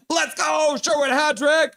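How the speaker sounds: high, loud goal celebration; elated fan yell; triumphant shout. Real, not acted. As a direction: shout it out for the hat trick. Celebrating the hat trick.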